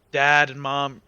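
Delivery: normal talking voice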